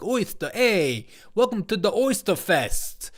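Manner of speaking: loud, hammed-up New York accent. Host excusing Biden's oyster slip, mock New York accent. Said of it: "It's a New York thing".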